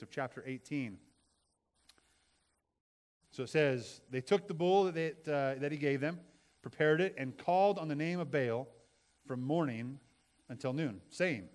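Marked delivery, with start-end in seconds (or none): 4.1-11.5 loud, fervent Bible reading; scripture reading. Acted. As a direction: proclaim the passage with fervor. Preacher reading scripture aloud.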